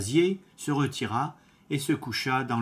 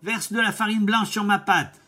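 higher, louder bossy wolf voice; wolf's demand; 9 semitones higher, 6 dB louder than usual. Acted. Wolf ordering the miller to whiten his paw, loud and bossy.

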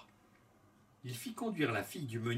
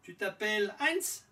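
higher, hopeful guess; queen's voice; 8 semitones higher than usual. A narrator's reading. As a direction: ask the name hopefully, higher. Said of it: "Elle répondit d'abord".

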